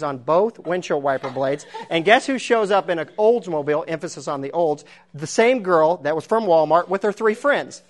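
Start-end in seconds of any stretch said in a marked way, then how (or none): none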